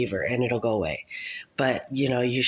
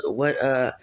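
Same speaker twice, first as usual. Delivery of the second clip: normal talking voice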